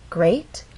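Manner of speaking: "Great" glides up on its one syllable; gliding rise. Shown as a demonstration. Teacher showing one syllable glide up, rising intonation. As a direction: slide "Great" upward like a question. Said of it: "make our voice glide up".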